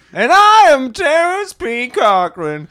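grand character voice. Acted. Character introduces himself grandly.

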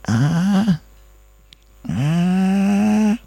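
high, rising, long "ahhh"; long high vowel of dread before confessing; hesitant drawn-out "ah". Retold or imitated. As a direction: stall on a high stretched "ah". Stalling before an awkward confession.

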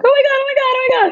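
high-pitched, rapid-fire oh-my-gods; excited retelling. Retold or imitated. Running upstairs to tell partner, excited and high. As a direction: gush it in a high, breathless-excited rush.

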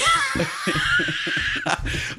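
high shrieking laugh burst. Real, not acted. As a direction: let out a squealing laugh.

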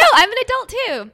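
put-on very high protesting little girl; preteen girl. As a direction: insist like an indignant kid. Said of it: "I'm like".

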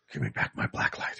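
low hushed whisper. Pretend. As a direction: breathe it out, low and secret. Whispered joke line.

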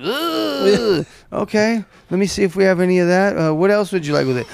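high fast fussing; mock flustered girlfriend. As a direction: hurry to serve him. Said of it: "when she's like".

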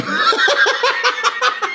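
helpless high-pitched laughter; high wheezing laugh. Real, not acted. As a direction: crack up at your own story. Cracking up at his own story.

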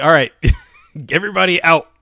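loud order broken by a laugh; mock evacuation order. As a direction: call it out like a mock official, laughing.

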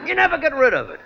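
thick working-class Brooklyn speech; Brooklyn accent. Acted. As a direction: talk like a Brooklyn mug. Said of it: "your brothers all talk like this".

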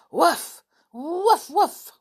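high, loud muffled woofs; yappy woofs rising each time; dog barking. Acted. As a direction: bark like an excited dog. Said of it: "his doggy voice could be heard".

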